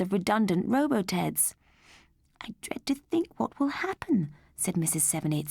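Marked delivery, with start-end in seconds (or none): 2.4-4.3 higher, anxious mother's voice; worried mother. Acted. Mother fretting to her husband about Mandy, higher worried voice. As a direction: fret like a worried mum.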